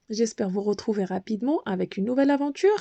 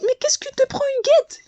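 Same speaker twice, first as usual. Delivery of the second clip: high, frightened squeal; panicked. A narrator's reading. The little bee pushed into the water, panicked high cry. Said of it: "la petite abeille affolée".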